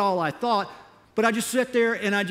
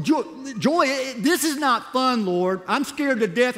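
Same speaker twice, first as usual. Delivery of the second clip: higher, louder, indignant protest; madder; 6 semitones higher, 3 dB louder, slower than usual. Retold or imitated. Missionary, scared and angry, arguing with God over the verse. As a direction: throw the verse back at God, exasperated. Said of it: "That made me madder".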